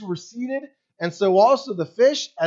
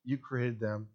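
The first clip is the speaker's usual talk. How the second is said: much lower and softer, tender trust; calm trusting prayer; 8 semitones lower, 10 dB quieter than usual. Retold or imitated. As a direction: hush down into quiet faith. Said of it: "Instead of".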